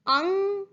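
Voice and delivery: अंग with the ng held long; nasal anusvara. Shown as a demonstration. Teacher breaking down the word for grapes, अंग held. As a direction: stretch the nasal in अंग as a separate syllable. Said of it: "मतलब अ के ऊपर अनुस्वार".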